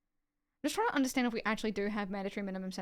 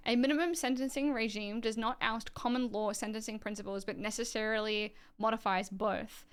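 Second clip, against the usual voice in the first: usual speaking voice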